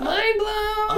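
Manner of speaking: squeaky high mock amazement; high-pitched voice. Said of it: "they're gonna be like".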